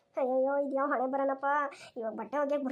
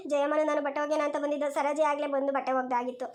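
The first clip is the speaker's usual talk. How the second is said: normal talking voice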